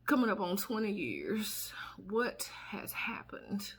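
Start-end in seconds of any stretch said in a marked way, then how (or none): 2.1-3.7 slow whisper; whispered disbelief. Real, not acted. Stunned that twenty years passed, a slow whisper.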